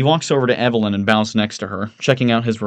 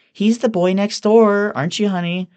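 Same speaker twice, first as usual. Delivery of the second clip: cooing Evelyn; 12 semitones higher, 4 dB louder than usual. Acted. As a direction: coo at a boyfriend. Evelyn cooing at her boyfriend.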